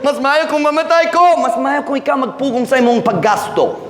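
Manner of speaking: overdone despair; mock despair. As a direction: act out someone wishing they were dead. Voicing despair over debts.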